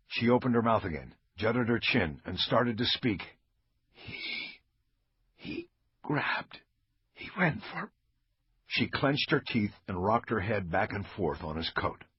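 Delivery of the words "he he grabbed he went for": faint, softer broken whisper; weak whisper. Acted. Wounded officer struggles to tell Bosch, faint whisper. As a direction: push out each word with effort. Dying woman struggles to speak.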